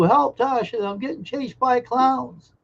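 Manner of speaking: hammed-up plea, chased by clowns; panicked cry for help. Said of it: "He'll be calling for you".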